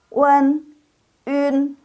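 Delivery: Wen, un as wen and ün, high and level; model wen, ün. Shown as a demonstration. Teacher models the two sounds, high and spaced. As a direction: sustain Wen, then purse lips for un. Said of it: "yang ini hanya dua".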